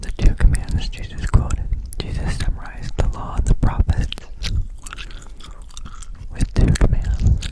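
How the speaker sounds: usual speaking voice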